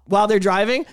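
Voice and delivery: ordinary delivery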